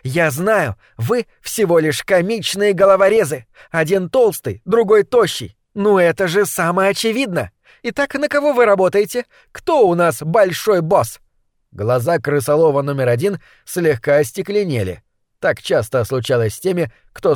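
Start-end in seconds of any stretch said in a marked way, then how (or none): 0.0-11.2 put-on bold girl voice. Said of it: "заявила злокозня".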